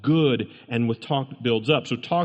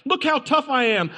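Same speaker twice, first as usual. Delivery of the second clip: much higher repeat of the boast, hammed-up; imitating a braggart shouting it; mock tough guy, louder; 11 semitones higher, 3 dB louder than usual. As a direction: push the mock swagger further. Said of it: "it screams for those people".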